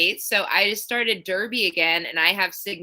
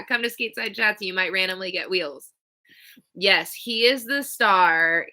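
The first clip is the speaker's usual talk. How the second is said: ordinary talk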